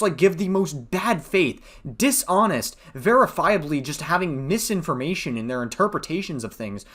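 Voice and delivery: normal talking voice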